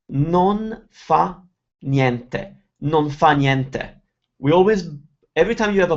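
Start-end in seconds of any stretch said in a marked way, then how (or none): 0.1-2.6 slow, pause after "Non fa", then "niente"; word by word. Shown as a demonstration. Teacher separates the phrase for learners, word by word. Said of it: "We never pronounce individual words". 2.8-4.0 "Non fa niente" joined as one block; words brought together. Shown as a demonstration. Teacher shows a block of words, run together. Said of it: "we bring them together".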